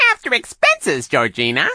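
high, loud interjection; Shirley. A narrator's reading. Accomplice reminds the hypnotist about money, high and loud. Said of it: "Shirley reminded her".

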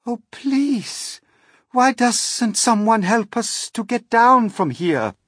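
high, loud plea; pleading call. A narrator's reading. Miss Spider begging rescue from the peach, pleading call. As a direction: call down for help. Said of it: "Miss Spider called out".

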